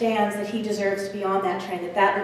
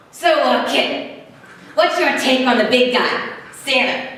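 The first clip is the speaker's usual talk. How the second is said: loud, punchy hobo voice; hobo impersonation; 3 dB louder than usual. Acted. Hobo quizzing a boy on Santa, punchy impersonation. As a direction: play a streetwise hobo sizing up a kid. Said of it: "I have a hobo impersonation".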